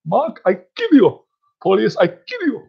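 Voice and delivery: very high, squeaky mock threat; comic terrorist impression. Amateur impressionist attempting a terrorist, high comic threat. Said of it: "I can't do a terrorist impression".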